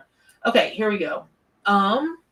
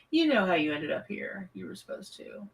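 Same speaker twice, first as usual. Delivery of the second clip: whispering; 8 dB quieter than usual. Real, not acted. Mysterious aside to a viewer.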